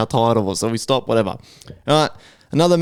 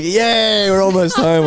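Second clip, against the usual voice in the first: high, mock-cheery sarcasm; sarcastic cheer; sarcastic hooray; 8 semitones higher, 3 dB louder, slower than usual. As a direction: fake celebration, way up high. Mock cheer while being towed.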